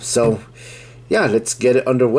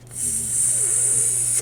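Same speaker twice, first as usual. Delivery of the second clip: long high hiss; snake hiss; 14 dB quieter than usual. Pretend. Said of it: "trying to talk like a snake".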